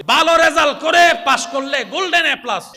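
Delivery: high, loud preaching line. Acted. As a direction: lift it to the back row. Preacher lifting voice.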